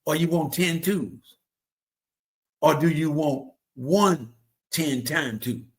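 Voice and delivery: drawn-out, deliberate dad riddle; slow teasing riddle. Retold or imitated. Father teasing son asking for twenty, slow sly riddle. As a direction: spell it out slowly like a sly father. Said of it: "He said".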